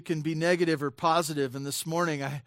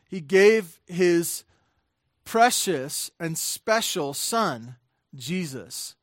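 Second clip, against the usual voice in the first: slow emphasis; slower than usual. Real, not acted. Weighty climax of sermon point.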